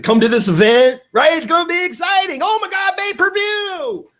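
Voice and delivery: shouting high, stretching out pay-per-view; hyped promoter. Retold or imitated. Promoter hyping a fight to the crowd, shouting. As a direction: sell the fight like a boxing promoter.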